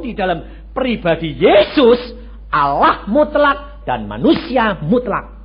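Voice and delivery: slow, loud, high sermon proclamation; preaching at full force. Acted. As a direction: proclaim each word slowly. (khotbah lantang dan lambat)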